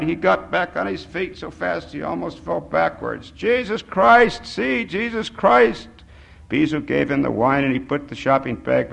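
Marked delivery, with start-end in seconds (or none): 3.3-5.9 high, slow, stretched exclamations; crying out. A narrator's reading. Old man jumps up echoing Bisou, crying out. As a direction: echo the oath as a fervent cry. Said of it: "the man cried".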